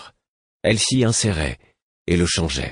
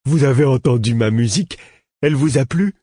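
higher, louder, eager questions; 7 semitones higher, 5 dB louder than usual. A narrator's reading. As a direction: beg for praise like a proud child. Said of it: "d'un ton avide".

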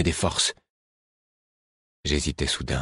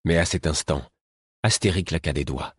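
usual speaking voice

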